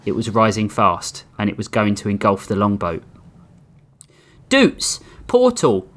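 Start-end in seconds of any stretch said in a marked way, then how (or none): 4.5-5.9 urgent shout across the deck. A narrator's reading. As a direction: yell the name, high and loud. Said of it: "Mookie shouted".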